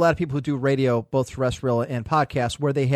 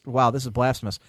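normal talking voice

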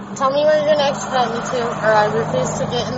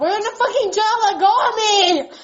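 upset shouting; 5 semitones higher, 4 dB louder than usual. Real, not acted. Detainee resisting in jail.

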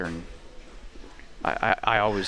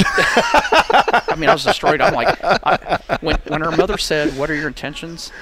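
laughing retell; 6 semitones higher, 9 dB louder, slower than usual. Real, not acted. Recalling the prank, laughing.

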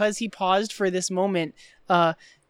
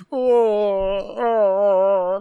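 long drawn-out, louder groaning filler; hammed-up stalling; 6 dB louder than usual. Imitating secretary faking her boss on the phone, hammed-up stalling. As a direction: stall with a put-on, constipated drone. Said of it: "doesn't know how to be Rooney".

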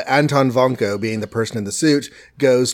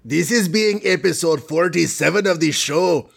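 higher-pitched put-on cartoon Russian accent; hammy Russian villain accent; Boris Russian accent; 5 semitones higher than usual. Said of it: "I'm not gonna do that voice".

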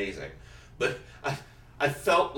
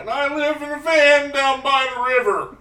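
hammed-up shouty character quote, slowing on the age; SNL sketch character; 9 semitones higher, 12 dB louder than usual. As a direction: boom it out like a washed-up life coach. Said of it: "Then I would change it up".

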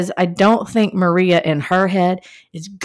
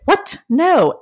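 mock surprise; 9 semitones higher than usual. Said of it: "Maria looks like".

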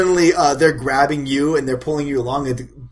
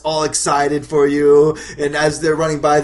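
usual speaking voice

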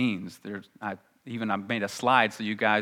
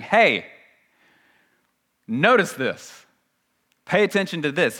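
raised, emphatic appeal in short separate phrases; calling for attention; 5 semitones higher, 4 dB louder than usual. Retold or imitated. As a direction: make listeners sit up and take note. Voices Paul's call for attention.

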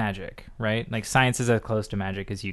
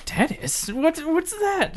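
much higher, slow puzzled voice; mock bafflement; slower than usual. Viewer baffled by old-timey tennis, high mock confusion. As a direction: play dumb at a strange new sport. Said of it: "and you're like".

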